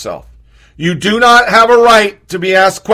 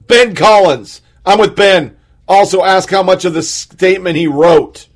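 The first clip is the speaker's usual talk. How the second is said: ordinary talk, nothing marked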